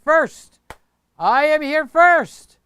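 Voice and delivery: loud, high, slow insistence; mock loud boast. Reading a viewer's comment, loud mock boast. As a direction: shout it like a kid claiming first place. Viewer chat read as shouting.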